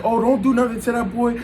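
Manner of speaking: high, pleading mock girlfriend voice. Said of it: "So she's like".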